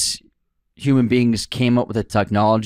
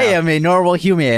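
robot voice; 7 semitones higher, 4 dB louder than usual. Pretend. Mock robot human claim.

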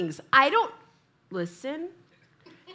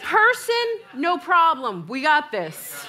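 mock bravado; 5 semitones higher, 5 dB louder, slower than usual. Mimicking confident police reply.

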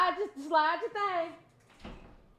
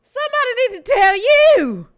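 much louder and higher outrage; loud indignant rant; 9 semitones higher, 12 dB louder than usual. Real, not acted. Co-host loudly insisting someone tell him to back up. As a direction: shout your indignation.

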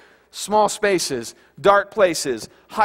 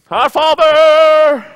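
booming, drawn-out holy voice, much higher and louder; pompous prayer voice; 9 semitones higher, 11 dB louder, much slower than usual. Retold or imitated. Mocking churchgoers who pray for show, booming and drawn out. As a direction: ham up a showy churchy prayer. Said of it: "they go".

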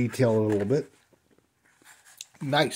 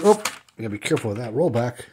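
ordinary talk

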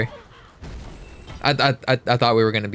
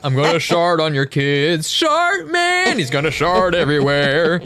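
silly high voice; 5 semitones higher than usual. Pretend. Goofy high voice bit.

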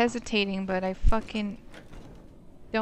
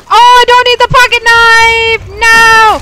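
very high, loud panicked screams; panicked screaming; 10 semitones higher, 14 dB louder, slower than usual. Real, not acted. Streamer attacked without his knife, panicked screaming.

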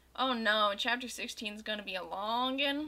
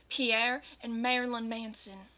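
usual speaking voice

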